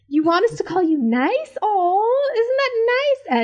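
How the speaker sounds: Sandy teasing Bobby, high and sing-song; teasing coo. Retold or imitated. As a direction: coo at him like a little kid.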